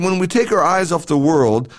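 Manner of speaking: ordinary delivery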